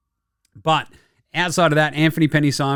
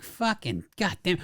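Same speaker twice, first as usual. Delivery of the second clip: slow, much softer exasperated mutter; muttered curse; 3 semitones higher, 10 dB quieter than usual. Retold or imitated. Fan fed up with the team, muttering softly and slowly. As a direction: grumble it under your breath, dragging.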